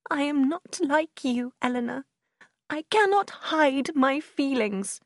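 high, slow, distressed voice; crying. A narrator's reading. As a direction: protest tearfully to a calmer sister. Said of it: "Marianne began to cry".

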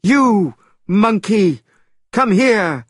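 loud, high summons; shouted. A narrator's reading. The immortal shouting Monkey over, loud and high. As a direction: call Monkey over sharply. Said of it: "shouted the immortal".

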